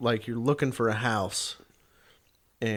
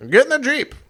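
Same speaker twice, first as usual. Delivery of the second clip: high, loud command; shouted order; 9 dB louder than usual. Retold or imitated. Fred orders friend along on mission, shouting. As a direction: holler it, no discussion. Fred's barked order, retold.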